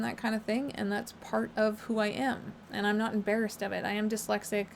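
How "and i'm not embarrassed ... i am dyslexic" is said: ordinary talk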